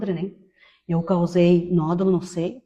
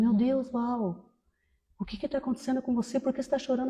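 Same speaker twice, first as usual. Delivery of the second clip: worried client; 4 semitones higher, 9 dB quieter than usual. Retold or imitated. Client worried as hairdresser cries, higher soft worried voice. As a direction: gently imitate an anxious friend. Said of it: "preocupada comigo".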